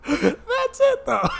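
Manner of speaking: high, squeaky laugh-talk; helpless high laughing voice. Real, not acted. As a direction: get it out through real laughter. Cracking up at the quote.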